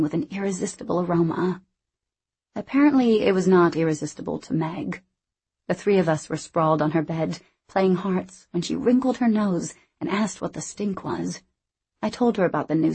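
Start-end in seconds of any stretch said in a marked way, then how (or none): none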